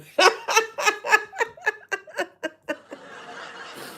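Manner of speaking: high, delighted laugh; long happy laugh; real laugh. Real, not acted. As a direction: laugh out with pleasure. Laughing at the compliment.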